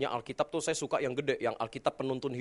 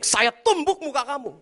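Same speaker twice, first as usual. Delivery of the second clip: loud, high fist-raised threat; threatening shout; 10 semitones higher, 8 dB louder than usual. Retold or imitated. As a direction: threaten to punch him. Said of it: "dia bilang begini". (ancaman marah dengan teriakan)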